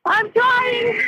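high, crying words. Real, not acted. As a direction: sob it out. Crying in sinking car.

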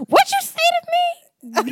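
very high, loud mock disbelief; hammed-up, squeaky flirty reaction; turned on. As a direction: play up being thrilled by it. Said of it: "I'd be so turned on".

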